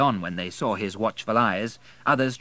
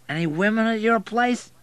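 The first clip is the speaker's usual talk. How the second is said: put-on high, loud drunk question; slurred, pitched-up inquiry; drunken man; 14 semitones higher, 6 dB louder than usual. A narrator's reading. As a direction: ask it eagerly as a drunk. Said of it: "He was so drunk".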